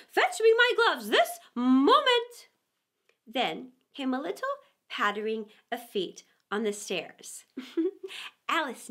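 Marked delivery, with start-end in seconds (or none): 0.1-2.5 high, loud, slow command; rabbit's order. A narrator's reading. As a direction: bark the order like an impatient master.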